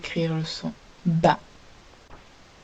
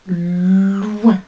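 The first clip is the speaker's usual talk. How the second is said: loin lancé fort; mot dicté; 8 dB louder than usual. Shown as a demonstration. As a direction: annoncer loin clairement. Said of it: "Le mot numéro un". (loin, articulé fort pour la dictée)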